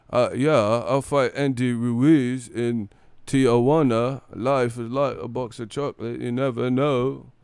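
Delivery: slow, drawled Forrest Gump impression; mock interview in a slow Gump voice; Joshua as Forrest Gump. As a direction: stretch it out like a simple Gump. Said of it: "that's how Joshua kinda sound".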